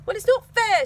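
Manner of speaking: grumbling. Acted. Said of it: "she grumbled".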